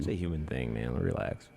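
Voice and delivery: low, soft mock reassurance; mock calming. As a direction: hush them, it's natural. Mock soothing retort to critics.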